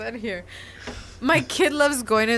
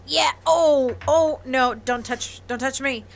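mock pained flinch; 4 semitones higher, 5 dB louder than usual. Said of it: "I'm like".

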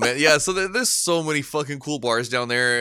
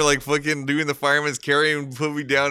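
normal talking voice

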